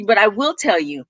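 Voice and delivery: ordinary delivery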